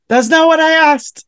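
squeaky mock outrage at God's answer; mock indignant. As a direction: throw it up high like an indignant kid. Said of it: "one of those answers where you're like".

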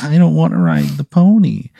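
put-on high, reluctant child voice; whiny little kid. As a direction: balk like a small kid on a field trip.

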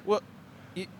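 high, slow, awkward South African; South African accent. Acted. Clerk awkwardly explaining his refusal, high South African accent. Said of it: "jump in the South African".

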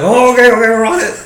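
high, loud, hurried caving-in voice; flustered agent. Retold or imitated. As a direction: play the panicked agent giving in fast.

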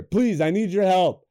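ordinary delivery

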